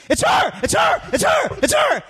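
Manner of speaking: high, squealing repeated cry; losing it at spotting a star; high frantic shouting to a friend, pointing; frantic excited shout. Retold or imitated. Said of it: "I'm like".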